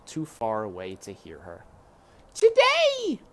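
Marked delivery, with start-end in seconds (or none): 2.3-3.2 put-on high, loud child's shout; loud high girl's call; Meg shouting. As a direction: shout it after the riders. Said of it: "shouted Meg".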